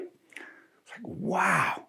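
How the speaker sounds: breathless whispered wow; stunned whisper. Retold or imitated. Storyteller reacting to the outburst, in a stunned whisper. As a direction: hush it out, stunned.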